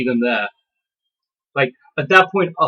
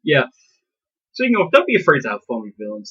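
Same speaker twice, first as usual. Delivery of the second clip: ordinary talk, nothing marked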